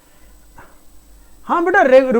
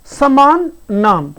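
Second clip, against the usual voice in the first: slow emphasis; 4 dB louder, much slower than usual. Shown as a demonstration. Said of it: "stresses meaning slowly".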